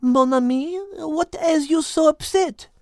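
put-on slow, lightly French-accented concern; gentle French-tinged question; concerned French Liz.

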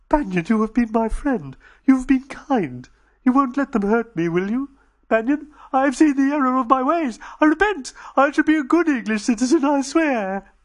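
high, loud pleading alien voice; Dodo begging. Acted. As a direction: beg a friend for mercy.

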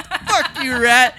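high, loud jeer at a rat; cocky, high-pitched kiss-off; defiant taunt. Acted. Gives rats the middle finger.

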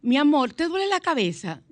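high, loud sugary asking; sweet wife. Acted. Wife sweetly asking her sick husband, high and loud. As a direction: coax him sweetly like a wife.

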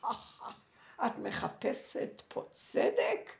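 slow, drawn-out taunt with acted laughter; imitated scornful villain laugh; mocking laughing snake. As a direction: play a giant serpent jeering at a victim. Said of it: "והוא צוחק, כאילו הוא צוחק".

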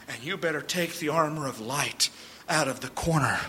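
quiet sober sermon warning; hushed solemn preaching. Acted. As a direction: bring it down to a grave hush.

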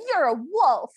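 retold yell. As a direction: yell it out in mock alarm.

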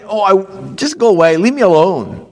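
irritated brush-off; grumpy husband. Pretend. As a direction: wave her away. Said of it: "it's not that".

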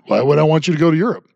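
ordinary talk, nothing marked

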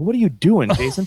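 mock disbelief. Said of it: "I'm like".

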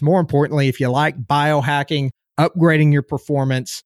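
normal talking voice

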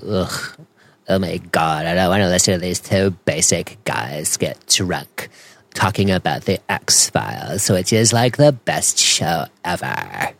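put-on voice of a disgusted listener; mock someone who finds the show beneath them; exaggerated impression of a put-off listener; mocking snobby listener. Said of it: "those people who talk like this".

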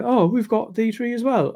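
a hint of prim Bridgerton-style English accent; posh English accent. Posh couple insisting they hold D three, mock posh English accent. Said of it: "to talk like Bridgerton".

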